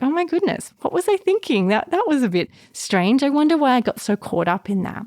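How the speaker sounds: high, mildly incredulous look back; mock astonishment. Speaker voicing someone looking back days later, mock astonished. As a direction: act out a high-pitched realization. Acted hindsight reaction to own thinking.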